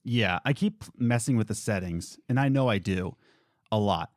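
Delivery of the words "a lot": usual speaking voice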